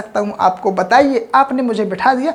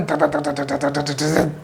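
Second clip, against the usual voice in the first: low, soft rhythmic imitation of running steps; running thuds; 8 semitones lower, 5 dB quieter than usual. Shown as a demonstration. Teacher imitating a fast runner, low rhythmic thuds. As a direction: thump it out low like pounding feet. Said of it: "वो बहुत तेज़ दौड़ता है".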